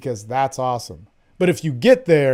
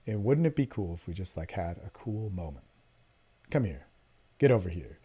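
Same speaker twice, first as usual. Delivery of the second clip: low seductive murmur; 7 semitones lower, 8 dB quieter than usual. Shown as a demonstration. Coach demoing his line to a date, low seductive murmur. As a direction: drop low and soft, pulling her in.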